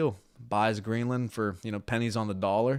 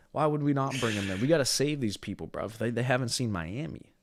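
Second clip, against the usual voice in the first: ordinary talk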